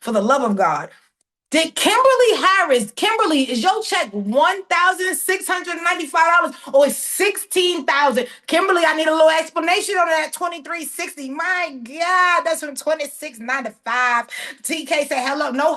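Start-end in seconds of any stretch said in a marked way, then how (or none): 1.5-10.3 marveling, pitched well up; amazed excitement. Real, not acted. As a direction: call out the figures as if stunned. Amazed at a large check.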